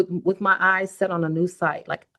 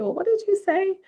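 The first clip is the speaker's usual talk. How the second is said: mom's question. Retold or imitated. Mom asks daughter, retold.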